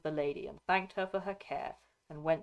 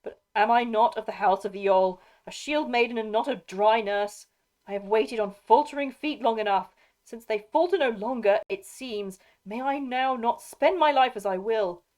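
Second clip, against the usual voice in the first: higher, louder protest from a proud shield maiden; impassioned cry; 5 semitones higher, 8 dB louder than usual. A narrator's reading. Shield maiden refusing to wait longer, crying out in protest. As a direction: cry out in indignant challenge. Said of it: "she cried".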